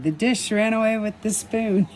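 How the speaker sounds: high, lifted storybook quote; playfully acted-out nursery rhyme line; old friend's boast. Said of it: "he's probably the one saying".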